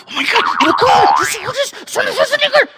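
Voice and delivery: high, loud squealing freakout; freaked-out fan. Retold or imitated. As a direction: lose it like a starstruck fan. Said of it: "and I freaked out".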